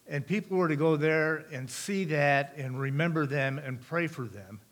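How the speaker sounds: normal talking voice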